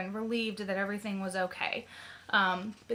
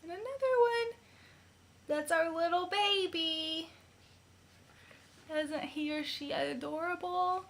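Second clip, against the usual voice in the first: high, soft sing-song coo; 8 semitones higher, 5 dB quieter than usual. Real, not acted. Mother showing off her baby's ultrasound pictures, cooing high.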